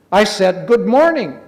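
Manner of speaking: loud, high-pitched indignant repeat; insistent greeting. Acted. Rabbit repeats his ignored greeting, loud and insistent.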